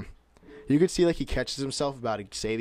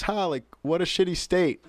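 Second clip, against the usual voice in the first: higher, scoffing imitation; mock disdain, pitched up; mocking professor; 7 semitones higher, 3 dB louder than usual. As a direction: retell a sneer at someone's home state. Retold professor's jeer at Jersey.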